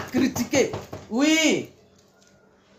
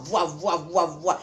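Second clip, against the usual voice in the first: echoing voix; 6 semitones lower, 3 dB quieter, slower than usual. Acted.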